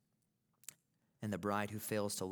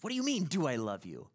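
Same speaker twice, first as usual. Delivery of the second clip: higher, louder, disbelieving retort; incredulous wife; 7 semitones higher, 8 dB louder, faster than usual. Retold or imitated. Wife retorting to husband's question, loud incredulous retort. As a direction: act out Goldie's indignant disbelief. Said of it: "looks at him incredulously and says".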